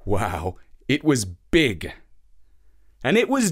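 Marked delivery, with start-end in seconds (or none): none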